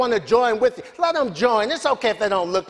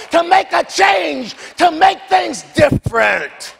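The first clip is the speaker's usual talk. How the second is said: rising preaching; 6 semitones higher than usual. Acted. Preacher builds to full force.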